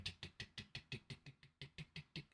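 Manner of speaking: soft unvoiced tick tick tick, rising; whispered ticking. GM voicing the crossbow drawstrings winding back up as a soft whispered ticking. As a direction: tick it off under your breath like a winding spring.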